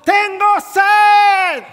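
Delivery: grito desesperado. Retold or imitated. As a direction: bellow it like a parched deer crying for water. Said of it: "Él está gritando". (grito agudo y muy fuerte, lento, imitando al ciervo)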